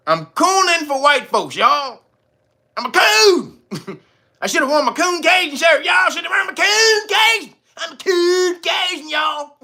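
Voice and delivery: high, hammed-up declaration; mock confession. Host answering a commenter who accused him, hammed-up mock confession. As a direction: proclaim it in exaggerated, high-pitched outrage.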